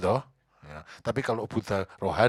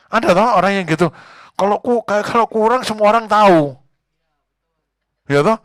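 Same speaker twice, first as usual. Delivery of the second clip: much higher, much louder; emphatic preaching; 9 semitones higher, 13 dB louder than usual. Acted. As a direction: drive it home. Preaching at full force.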